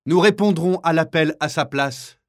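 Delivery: high, loud, resolute declaration; fierce defiance. Acted. Martin answering the kapo for the man, fierce and loud. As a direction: declare it fiercely to his face.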